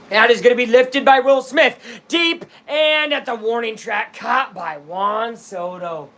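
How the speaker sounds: high, urgent fly ball call that drops at the catch; live play-by-play. Real, not acted. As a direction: call it like a sportscaster, easing off at the end.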